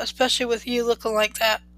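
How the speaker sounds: plain reading voice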